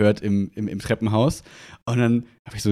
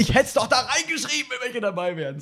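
inner scream; 10 semitones higher, 6 dB quieter than usual. Retold or imitated. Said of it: "das Innere in mir hat geschrien".

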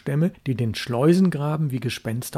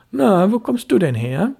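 mother asking; 7 semitones higher, 4 dB louder than usual. Acted. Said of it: "in ritterlichem Ton fragte".

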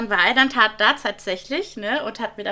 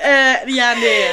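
laughing through words; 4 semitones higher, 8 dB louder, much slower than usual. Real, not acted. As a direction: still laughing, louder. Lacht noch weiter. (durch Lachen gesprochen)

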